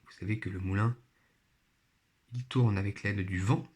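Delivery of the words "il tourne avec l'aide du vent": ordinary delivery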